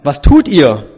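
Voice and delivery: loud mother's question from a window; acted shout to children below; calling down. A narrator's reading. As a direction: call the question out loudly.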